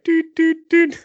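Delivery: three high, even beep tones; imitated phone beeps. Imitating the phone cutting the caller off, high beeps. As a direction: mimic a hang-up tone, high-pitched. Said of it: "hör man det klicka mig".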